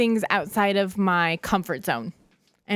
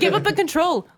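laughing; 10 semitones higher than usual. Real, not acted. Teasing Lena about being boss.

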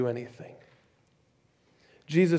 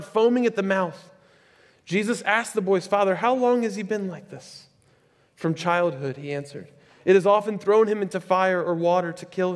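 scripture reading; 3 semitones higher, 3 dB louder than usual. Acted. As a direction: proclaim the passage to the congregation. Reads the Bible passage aloud.

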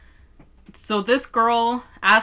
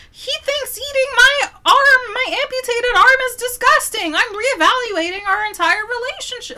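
put-on high, wailing send-up of an upset girlfriend; mock outrage; 14 semitones higher, 5 dB louder than usual. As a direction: exaggerate the melodramatic complaint, high-pitched. Imagined girlfriend's overdramatic complaint.

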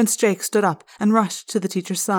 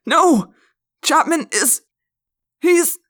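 desperate cry; 9 semitones higher, 7 dB louder, slower than usual. A narrator's reading. Teacher's desperate accusing cry.

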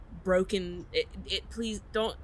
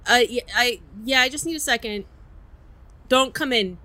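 raised, higher voice through a door; 4 semitones higher, 6 dB louder than usual. Acted. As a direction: call back from behind the door. Answering through bathroom door.

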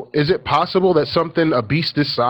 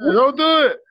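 high-pitched screamed plea; hammed-up panicky yell; mock scream; 13 semitones higher, 3 dB louder than usual. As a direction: wail the warning at the TV. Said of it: "I'm screaming at the sea lions".

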